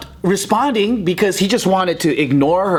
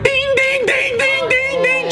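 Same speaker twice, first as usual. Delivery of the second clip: high, monotone repeated dinging; imitated call-bell; slower than usual. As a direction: chime like a frantically pressed call button. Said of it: "they're going".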